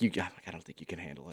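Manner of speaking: quick, hushed put-down under the breath; muttered aside. Retold or imitated. Husband tells how he doubted his wife could mow, quick hushed aside. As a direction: mutter it low and fast so she won't hear. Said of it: "And I'm like".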